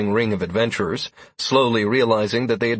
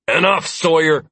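louder warning growl; 6 semitones higher, 5 dB louder than usual. A narrator's reading. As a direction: shut him down with a growl. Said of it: "Lazarus growled".

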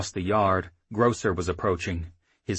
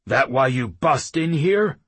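louder, higher, rising irritation; heated chief; 6 semitones higher, 7 dB louder than usual. A narrator's reading. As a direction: let the temper start to flare. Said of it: "his voice gaining a little heat".

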